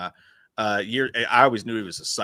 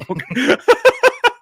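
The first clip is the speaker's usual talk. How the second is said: high, loud cackling laugh; 11 dB louder than usual. Real, not acted. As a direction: burst out laughing. Cracks up at the story.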